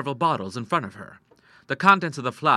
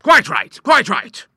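less hearty approval; 10 semitones higher, 13 dB louder, slower than usual. A narrator's reading. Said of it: "perhaps with less heartiness".

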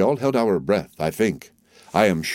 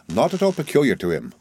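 ordinary delivery

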